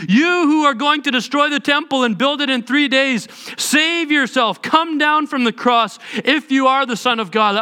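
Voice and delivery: high, loud sneering taunt; mocking crowd. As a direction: jeer at him from below the cross. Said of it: "shaking their heads and saying".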